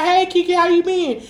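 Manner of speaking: high, sugary mock greeting; fake-friendly greeting. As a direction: greet her high and sweet like an old friend. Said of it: "And I be like".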